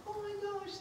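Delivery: put-on very high, breathy-light gushing girl; mock girly date voice. Daisy gushing at her dinner date, very high mock voice. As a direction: gush like a flattered date. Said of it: "I don't know if that's how you sound".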